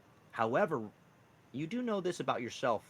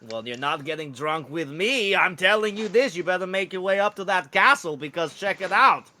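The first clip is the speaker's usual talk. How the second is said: rising to loud, high insistence; insistent meerkat merchant; 7 semitones higher, 8 dB louder than usual. Acted. Street contact ordering Meek to the castle, increasingly insistent. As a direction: push the order harder as it goes.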